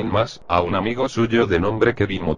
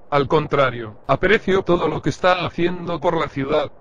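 higher, eager cashier's voice; friendly shopkeeper; 8 semitones higher than usual. Acted. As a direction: serve the customer brightly. (voz aguda de cajero amable)

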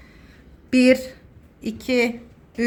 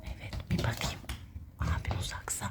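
whispering; 19 dB quieter than usual. Real, not acted. Whispering while hiding.

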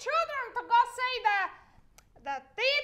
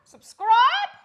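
surprised; 10 dB louder than usual. Real, not acted.

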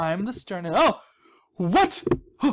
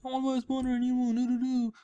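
pitched-up mock pleading customer; eager buyer impression; 7 semitones higher than usual. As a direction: play a needy player begging to buy. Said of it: "and they're like".

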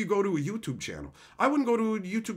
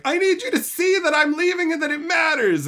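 loud high mocking shout; mock yell; 13 semitones higher, 8 dB louder than usual. Streamer mocking a troll yelling in caps, loud shout.

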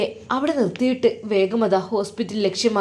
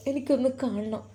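"എനിക്കൊന്ന് കാണണം" repeated softer, barely held together; a second, quieter begging; repeated soft plea; 7 dB quieter than usual. Acted. Brother repeating his plea to the nurse, softly tearful.

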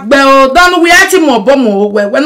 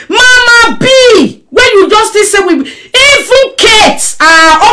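slow, high wailing cry of Mama B; mock crying shout; 7 semitones higher, slower than usual. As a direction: wail her name in mock grief.

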